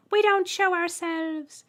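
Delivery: very high, loud piercing voice; Trembler. A narrator's reading. Alien greeting the human, piercing high voice. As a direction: squeak it out politely. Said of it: "the middle one replied, resisting the urge to giggle".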